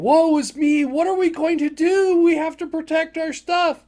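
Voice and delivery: high, loud mock lament; hammed-up woe. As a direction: wail like helpless victims. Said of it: "they're going".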